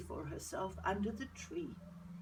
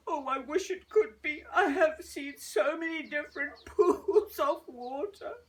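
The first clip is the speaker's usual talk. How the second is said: tearful laugh; 6 semitones higher, 7 dB louder than usual. Acted. Lady weeps and laughs.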